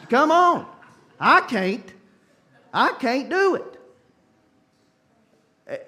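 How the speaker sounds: can barely get it out for laughing. Real, not acted. Preacher cracking himself up before the congregation, laughing.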